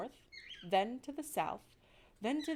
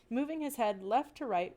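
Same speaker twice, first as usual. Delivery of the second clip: plain reading voice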